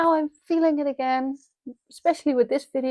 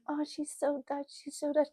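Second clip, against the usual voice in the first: softer, higher, sing-song teasing voice; mock commenter; 4 semitones higher, 10 dB quieter than usual. Mocking TikTok commenters about her accent, lightly teasing. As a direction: mimic a snide commenter quietly. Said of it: "and it's like".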